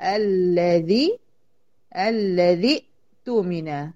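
الذي slowed right down, its ending cut short into the hamza of اؤتمن; joined pronunciation. Shown as a demonstration. Teacher shows how to link الذي اؤتمن, slow and deliberate. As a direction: slowly model الذي اؤتمن, dropping the joining alif. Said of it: "فبقيت انطقها ازاي؟".

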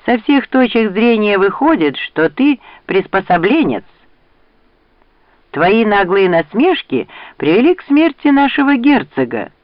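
high-pitched, emotional accusation; trembling voice. A narrator's reading. Weeping knight accuses Rand of his duke's death, trembling. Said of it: "дрожащим голосом проговорил тот, что плакал".

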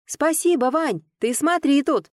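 called out thanks. A narrator's reading. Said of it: "крикнула".

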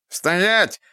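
high shrill squeal; shrill screeching bird woman. A narrator's reading. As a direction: screech like a rat-man. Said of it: "пронзительно завизжал он".

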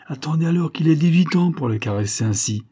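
acted with a touch of importance, pitch lifted; self-important. A narrator's reading. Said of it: "retrouvant toute son importance".

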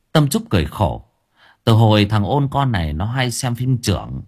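usual speaking voice

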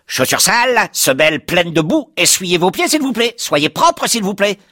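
hurlement très aigu de porte; porte qui hurle. Retold or imitated. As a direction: crie comme une porte hystérique. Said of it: "Elle s'est mise à hurler". (porte d'entrée qui hurle, voix suraiguë)

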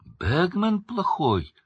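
high, drawn-out query; surprised. A narrator's reading. Harry questioning Winky about Bagman, surprised. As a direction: echo it in slow disbelief. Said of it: "удивился Гарри".

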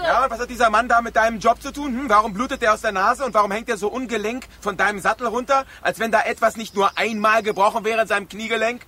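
high, loud indignant shouting; angry outburst. Acted. Sheriff confronts Liberty over the bleeding driver, shouting angrily. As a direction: demand answers, outraged.

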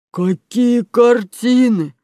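loud, high, very slow question; drawn-out innocent robber; feigned innocence. Acted. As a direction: stretch it out as a crook playing dumb. Caught robber plays innocent.

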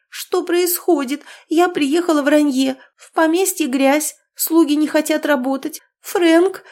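high, loud plaintive wail; wailing complaint. A narrator's reading. Niece complaining to her uncle, high wailing. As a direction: lament like a spoiled girl. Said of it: "Запричитала".

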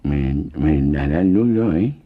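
put-on slow, stammering boy's voice, repeating the first word; a boy stammering out "Me, me dará el duro"; stuttering. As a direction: stutter it out slowly, breathless with effort. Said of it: "empieza aquel a tartamudear".

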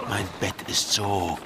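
seductive murmur. Acted. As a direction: draw the words out to tempt her. Seductive drowsy invitation. (verführerisches Raunen)

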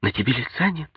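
lightly voiced anxious wife; worried wife. A narrator's reading. Wife worried at husband's look.

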